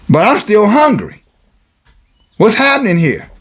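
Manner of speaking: high, challenging congregant confronting a pastor; indignant preaching. Acted.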